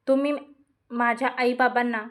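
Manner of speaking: ordinary delivery, nothing marked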